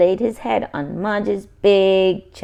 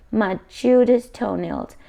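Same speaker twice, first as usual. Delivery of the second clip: plain reading voice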